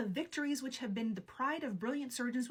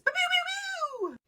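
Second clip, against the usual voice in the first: high, slow zapping pew pew sounds; laser gun noises; 8 dB louder, slower than usual. Acted. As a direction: fire a toy ray gun with your mouth. Laser sounds after future intro.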